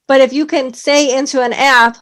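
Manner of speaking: ordinary delivery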